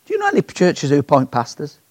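ordinary talk, nothing marked

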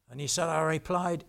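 usual speaking voice

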